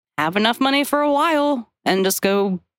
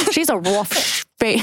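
put-on Australian accent. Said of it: "I'm trying to do an Australian accent".

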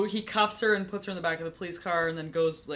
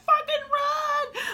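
sudden high screaming yell, slow and stretched; panicked scream; 4 dB louder, much slower than usual. Retold or imitated. Retelling the heroine fleeing the killer, panicked scream. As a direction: yell it like the killer just saw you.

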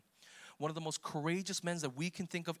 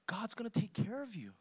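higher, rising fervor; fervent; 7 semitones higher than usual. Real, not acted. Preacher proclaiming the promise to the room, higher and fervent. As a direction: lift the promise up with conviction. Rising passion after quiet line.

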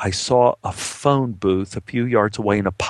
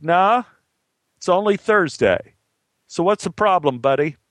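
higher-pitched mild call; calling back; 6 semitones higher than usual. Acted. Cops answer stranded man across the chasm, calling back. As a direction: voice the cops hollering across a gap, not full volume. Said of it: "They called out".

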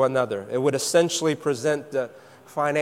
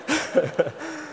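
short laugh; 10 semitones higher, 5 dB quieter than usual. Real, not acted. Laugh after joke.

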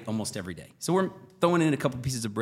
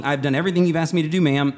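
loud, raised, insistent protest; retold exasperation; 4 semitones higher, 7 dB louder than usual. Customer retelling his plea to tech support, loud exasperation. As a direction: push back hard, mock fed up.

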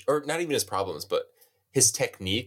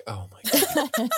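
exasperated; slower than usual. Retold or imitated. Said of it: "I was like".

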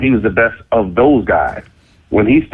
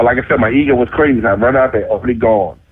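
ordinary delivery

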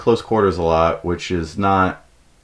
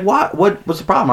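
defensive puzzlement; 11 semitones higher than usual. Retold or imitated. Acted girlfriend's puzzled reply.

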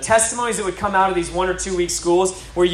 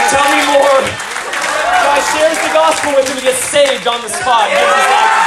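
higher and louder, building to a shout; rising excitement; 6 semitones higher, 5 dB louder than usual. Real, not acted. Preacher telling the crowd the fish miracle, building to a shout. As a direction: let the miracle story build to a triumphant shout.

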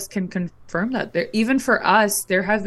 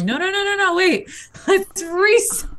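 frantic backpedal; 11 semitones higher than usual. Retold or imitated. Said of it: "And we're like".

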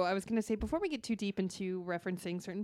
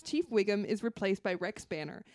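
ordinary talk

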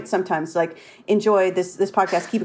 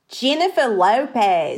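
Australian accent; 3 semitones higher than usual. Shown as a demonstration. Host showing how she gets into it, full Australian accent. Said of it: "This is how I get into it".

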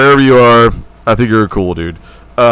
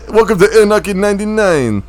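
mock Russian accent; 8 semitones higher than usual. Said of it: "He's like".

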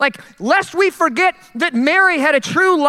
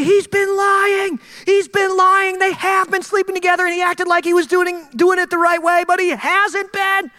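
accusing townsfolk; 6 semitones higher than usual. Retold or imitated. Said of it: "they all see".

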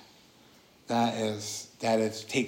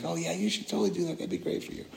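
cheerleading friend; 7 semitones higher, 4 dB quieter, faster than usual. Retold or imitated.

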